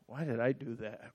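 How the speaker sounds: very soft, low self-reproach; subdued regret. Retold or imitated. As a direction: ask yourself quietly, full of regret. Regret after the retold outburst.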